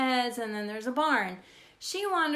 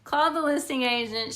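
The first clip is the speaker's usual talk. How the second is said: usual speaking voice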